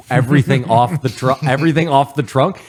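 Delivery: loud, high burst of disbelief; excited retelling. Retold or imitated. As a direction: blurt it out like you can't believe your luck. Wind blows weed off trunk.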